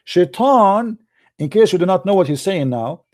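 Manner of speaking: normal talking voice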